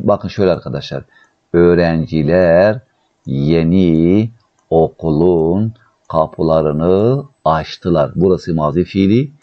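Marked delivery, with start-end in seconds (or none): 1.5-8.1 very slow, stretched word endings; word by word, with pauses between; slow dictation. Shown as a demonstration. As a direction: dictate slowly to a class. Teacher dictates the translation.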